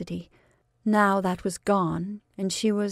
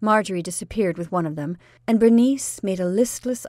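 usual speaking voice

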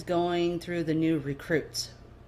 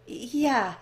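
stammering; 7 semitones higher, 4 dB louder than usual. Acted. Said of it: "you stammer".

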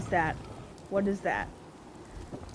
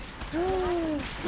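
sharp gasp; 5 semitones higher, 8 dB quieter than usual. Real, not acted. Both arms crippled in game.

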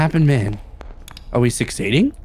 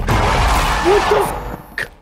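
scared squeal; much slower than usual. Real, not acted. Jumpscare, high unvoiced scream.

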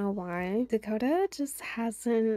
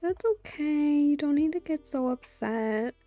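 higher-pitched cooing reassurance; 5 semitones higher, 4 dB louder than usual. Real, not acted. Soothing the upset game baby.

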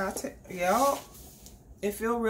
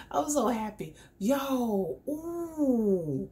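normal talking voice

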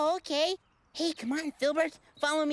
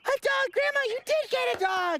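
thrilled; 10 semitones higher than usual. Real, not acted. Finding out grandma got a dog.

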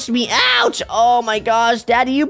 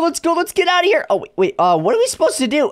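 ordinary talk, nothing marked

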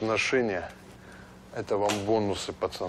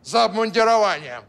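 stern officer voice; 8 semitones higher, 6 dB louder than usual. Acted. Kaban poses as a major.

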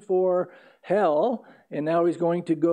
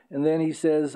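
ordinary talk, nothing marked

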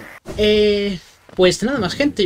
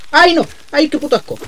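high shrieking disgust, much louder; 11 semitones higher, 9 dB louder than usual. Real, not acted.

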